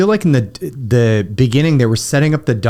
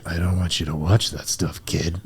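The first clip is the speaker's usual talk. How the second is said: much lower, softer fatherly grumble; mock stern father; mock gruff dad; 9 semitones lower, 10 dB quieter than usual. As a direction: growl it low like a stern dad. Father voiced in story.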